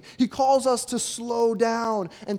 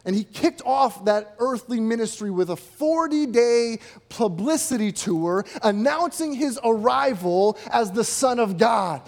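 usual speaking voice

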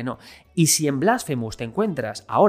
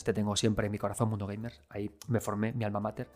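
dropped soft and low, fondly; a quiet, heartfelt dedication under the breath; tender aside; 4 semitones lower, 9 dB quieter than usual. Real, not acted. Softly thanking the old magazine where he learned his trade.

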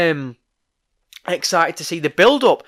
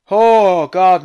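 normal talking voice

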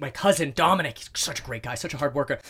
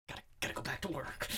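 breathy whispered inner voice, fast; 14 dB quieter, faster than usual. Retold or imitated. At his kids' playtime, the urge to work, whispered. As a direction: mutter it to yourself like a compulsion. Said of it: "I'm like".

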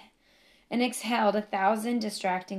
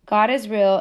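much louder, defiant proclamation; a defiant, much louder cry of faith; emphatic declaration; 10 dB louder than usual. Real, not acted.

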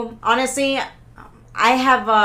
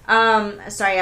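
ordinary talk

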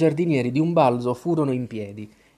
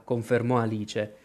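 ordinary delivery

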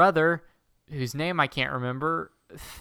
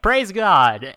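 hammed-up loud, high cry of praise; mock cheer; 6 semitones higher, 9 dB louder than usual. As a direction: shout it like a joyful crowd, half joking. Villagers cheer in retold scene.

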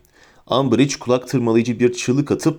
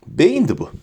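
ordinary delivery, nothing marked

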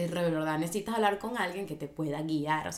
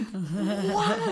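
long high-pitched comic bawl; hammed-up "waah" of sudden tears; mock crying wail; 3 semitones higher, 4 dB louder than usual. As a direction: burst into an exaggerated wail. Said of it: "Empezaba a llorar".

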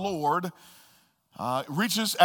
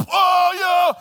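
high loud wailing oh of a fit; mock tantrum yell; 12 semitones higher, 8 dB louder than usual. Daughter throwing a fit in the van, loud high wail. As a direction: shout it like a child's tantrum.

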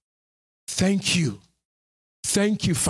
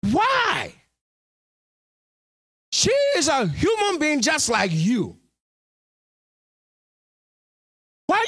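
high and pressing, climbing to a shout; pleading pulpit cry; impassioned preaching; 14 semitones higher than usual. Acted. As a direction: hammer the question at the flock. Preaching at full force.

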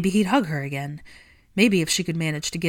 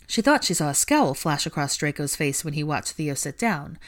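plain reading voice

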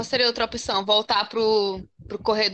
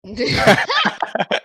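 laughing; 6 dB louder than usual. Real, not acted. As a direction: burst of laughter. Laughter at joke.

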